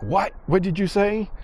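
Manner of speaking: high-pitched mock outrage; high, incredulous comeback; affronted disbelief. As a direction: imitate a stunned, insulted kid. Retold kid reacts to worst insult.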